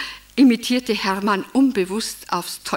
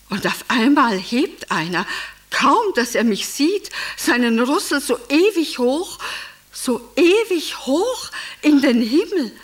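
swelling, higher-pitched wonder; awed excitement; 5 semitones higher, 3 dB louder than usual. Acted. Emmy recalls the elephant raising its trunk to her, awed and excited. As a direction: marvel as the pitch climbs.

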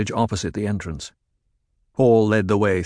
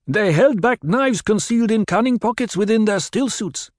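higher, different voice for the lieutenant; lieutenant's report; 12 semitones higher, 3 dB louder than usual. A narrator's reading. Korba explaining to Paul, voiced lieutenant. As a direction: report the failure, ashamed. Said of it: "the lieutenant said".

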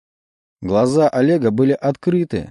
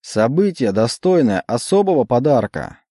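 solemn emcee; 4 semitones higher than usual. Acted. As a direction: declaim it like a showman host. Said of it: "торжественно декламировал он".

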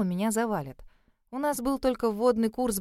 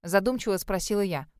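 ordinary delivery

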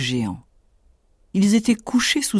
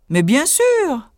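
slow, high boastful tailor; proud tailor; 9 semitones higher, 3 dB louder, slower than usual. Acted. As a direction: puff up like a cocky little hero. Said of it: "dit-il".

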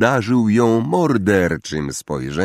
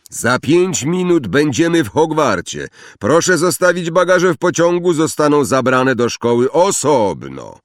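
loud, high announcing voice; announcement voice; 6 semitones higher, 5 dB louder than usual. A narrator's reading. Voice announcing arrival at Hogwarts along the train corridor, loud announcement. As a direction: call it out down the whole corridor. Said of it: "Przez korytarz przetoczył się głos".

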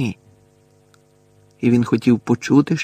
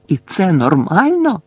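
raised, indignant cry; loud outraged question; shouted question; 7 semitones higher, 5 dB louder than usual. A narrator's reading. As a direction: cry out in disbelief, pitch up. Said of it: "закричав Гаррі".

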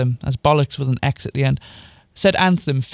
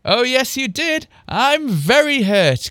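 higher-pitched pretend wounded voice; mock-hurt cry; 7 semitones higher, slower than usual. A narrator's reading. Girl teases her sister, mock-hurt. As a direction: play wounded, stretching the complaint. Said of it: "cried Anthem, trying to hold her laughter".

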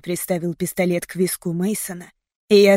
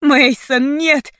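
high, louder anguished call; desperate cry; 6 semitones higher, 5 dB louder, much slower than usual. A narrator's reading. Heroine watching Mason attacked, anguished cry. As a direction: cry out from the heart. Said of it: "кричало моё сердце".

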